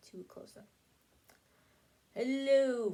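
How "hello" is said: loud, higher radio-host hello; show-opening greeting. Pretend. Host greets listeners of a private radio show, loud greeting. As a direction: belt out a big hello to open the show.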